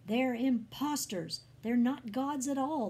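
loud, high accusation; angry roar. A narrator's reading. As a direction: denounce them to the crowd at full voice. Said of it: "the priest roared".

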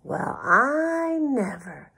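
slow, high drawn-out "I never"; amazed whisper. A narrator's reading. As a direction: stretch it out in astonishment. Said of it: "Duck whispered".

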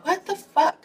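very high-pitched mock disbelief; retold bewilderment. Reacting as it does it again, high-pitched bewilderment. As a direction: replay your bafflement way up high.

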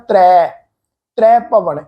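ਤਰੈ ਭਵਨ as "trai", wide ai vowel; mistaken open vowel. Shown as a demonstration. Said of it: "ਇਸ ਢੰਗ ਨਾਲ pronounce ਕਰਦੇ ਆ". (ਤਰੈ ਨੂੰ ਦੁਲਾਵਾਂ ਨਾਲ ਖੋਲ੍ਹ ਕੇ)

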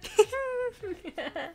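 high, soft laugh; quiet high-pitched giggle. Real, not acted. Own laugh.

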